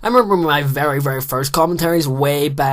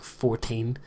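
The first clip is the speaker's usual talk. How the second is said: normal talking voice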